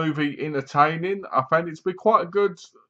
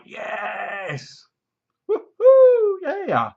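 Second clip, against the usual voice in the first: high, loud whooping yell; ecstatic cheer; 12 semitones higher, 4 dB louder, much slower than usual. Pretend. Viewer cheering the heroine's revenge stab, loud high whoop. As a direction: scream with joy for the heroine.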